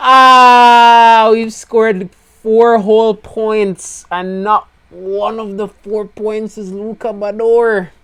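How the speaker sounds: high, slow, whining lament; anguished wail. Real, not acted. Team boss lamenting his driver's failure, high slow wail. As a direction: draw every word out in mock agony. Anguished rant about Bador.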